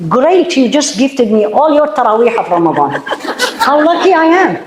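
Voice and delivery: chuckling, ironically thankful; laughing mock gratitude. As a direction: laugh it off as if gifted a prize. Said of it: "this is how I say".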